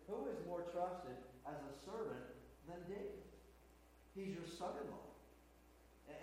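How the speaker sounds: hushed, earnest protest of innocence; a soft, pleading defence before the angry king; pleading priest. Retold or imitated. Priest Ahimelech quietly pleading his innocence to King Saul.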